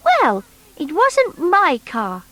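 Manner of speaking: put-on high, bright little toy boy; Noddy's voice. Noddy asking the strange creature, high boyish voice. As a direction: pitch up as young Noddy.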